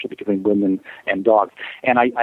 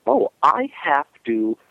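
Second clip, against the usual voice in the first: higher, slow voicing of a dog's thought; realizing dog; 8 semitones higher than usual. Dog looks up at owner and realizes, slow dawning voice. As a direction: act out a dawning realization, slowly. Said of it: "And he realized".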